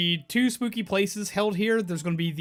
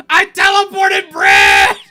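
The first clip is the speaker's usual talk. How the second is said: slow, high, loud shout; shouting Soldier line; 9 semitones higher, 14 dB louder, slower than usual. Retold or imitated. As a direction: bellow it like a proud soldier. Quoting Soldier from Team Fortress.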